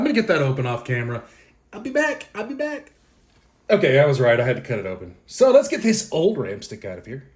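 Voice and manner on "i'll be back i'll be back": very high, quick little voice; high playful sign-off. Pretend. Vlogger stepping off camera briefly, high playful voice. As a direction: pipe it up high, twice.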